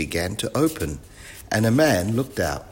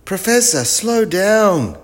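very high, loud shout; urgent yell; 13 semitones higher, 8 dB louder, slower than usual. A narrator's reading. Wilf warns the professor, high urgent yell. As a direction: yell urgently to get attention. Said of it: "yelled Wilf".

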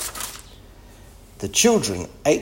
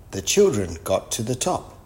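plain reading voice

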